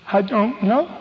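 put-on high little-child voice, rising at the end; childlike, upset answer lifting in pitch; tearful little girl. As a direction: imitate a crying six-year-old. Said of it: "She's standing there crying".

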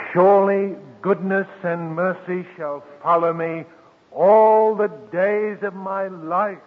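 slow, lifted psalm reading; solemn quoted verse; scripture recital. Acted. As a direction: lean into each word of the psalm. Preacher quoting Psalm 23 slowly.

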